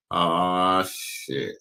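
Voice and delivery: ordinary talk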